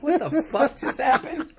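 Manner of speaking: high, slow, disbelieving yell while laughing; baffled shout. Pretend. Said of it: "all the other fighters are like".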